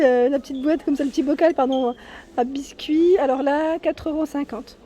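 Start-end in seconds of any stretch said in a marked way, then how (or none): none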